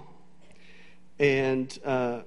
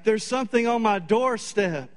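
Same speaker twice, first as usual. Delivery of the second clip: hysterical; 6 semitones higher than usual. Retold or imitated. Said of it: "she's just hysterical".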